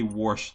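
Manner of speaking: warsh with an r before the sh. Shown as a demonstration. Explaining the regional word in a warsh pronunciation. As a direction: say warsh, adding an r to wash. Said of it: "mispronunciation of the word wash".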